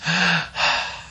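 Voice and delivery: acted winded huffing; heavy puffs of an exhausted man; out-of-breath panting. A narrator's reading. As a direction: pant as if just hobbled to the steps. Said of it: "He was out of breath".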